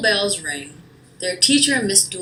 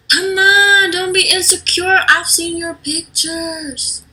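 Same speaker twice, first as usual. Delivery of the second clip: high, loud pushy friend; high excited friend urging; eager coaxing; 11 semitones higher, 5 dB louder than usual. Acted. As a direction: coax her on excitedly. Friend urges her.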